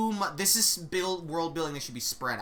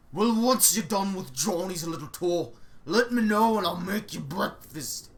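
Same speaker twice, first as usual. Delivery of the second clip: put-on loud, doting old cook voice; fussing cook; 4 dB louder than usual. Cook fussing over the skinny visiting witches, loud and doting. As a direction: coo over them like a busy kitchen matron.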